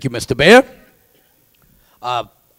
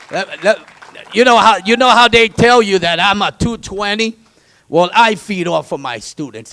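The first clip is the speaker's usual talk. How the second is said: loud, higher hyped-up talk; excited; 6 semitones higher, 10 dB louder than usual. Real, not acted. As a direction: burst with energy. Energized speaker.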